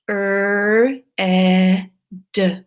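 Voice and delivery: "Er, eh, d" as separate sounds; segmented sound-by-sound demo; sounds one by one. Shown as a demonstration. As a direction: split red into its three sounds with small pauses. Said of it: "red has three sounds".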